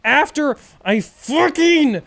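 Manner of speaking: loud, high exasperated restart; fired up about the delay; worked up again. Real, not acted. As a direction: burst back into the rant. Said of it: "Anyway, where was I?".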